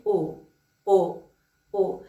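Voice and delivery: او drawn out slowly with rounded lips; a patient teacher sounding out او; clear o sound. Shown as a demonstration. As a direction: model او slowly and clearly for the class. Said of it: "به دهن من موقع تلفظ صدای او دقت".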